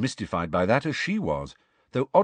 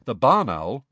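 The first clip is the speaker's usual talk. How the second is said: ordinary delivery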